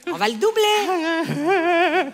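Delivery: high, very slow proclamation; drawn-out announcement. Acted. Agent announcing the rent will double, drawn-out. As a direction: stretch it out for maximum effect.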